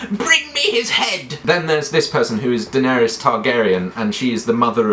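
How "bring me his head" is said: very high, slow squealed command; shrieky high-pitched mock order; Joffrey. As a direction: squeal it like a spoilt boy king. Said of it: "And he also says".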